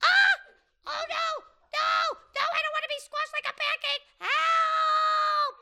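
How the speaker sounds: high, loud, fast panicked squeal; panicked rooster scream. Acted. Rooster about to be squashed, screaming in panic. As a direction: shriek for help like a squashed bird.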